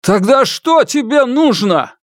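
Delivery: high, loud desperate cry; father shouts at the kidnapper holding his girl, high and loud; shouting. Acted. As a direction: yell it at the kidnapper. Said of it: "Сунь Дачунь закричал".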